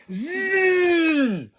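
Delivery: slow high buzzing zzz, shouted out; imitated buzz. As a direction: buzz like a wheeled toy spider. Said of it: "he was more like a".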